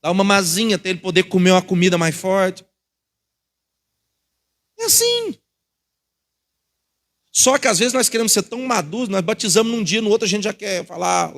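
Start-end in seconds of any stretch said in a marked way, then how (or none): 4.8-5.4 sudden high exclamation. Acted. Preacher high emphatic tag.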